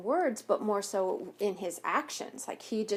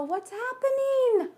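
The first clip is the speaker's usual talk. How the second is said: high, loud startled cry; alarmed; 14 semitones higher, 5 dB louder than usual. Acted. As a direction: gasp out the question in alarm. Goat's dandelion blowing away.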